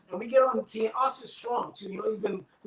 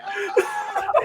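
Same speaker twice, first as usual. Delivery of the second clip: very high, loud excited yelp; imitating a buddy thrilled with his swing; hyped shout; 5 dB louder than usual. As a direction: whoop like you just crushed it. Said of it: "He was like".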